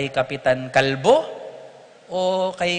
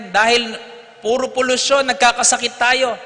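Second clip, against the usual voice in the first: raised, higher voice of a determined priest; priest's firm declaration; 7 semitones higher, 5 dB louder than usual. Retold or imitated. Priest to the congregation, loud and firm. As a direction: announce the closure like a vow.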